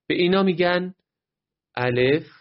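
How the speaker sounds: ordinary delivery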